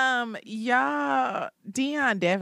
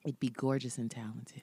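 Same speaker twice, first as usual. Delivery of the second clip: soft dreamy whisper; 9 semitones lower, 11 dB quieter than usual. Real, not acted. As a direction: breathe it like a dream.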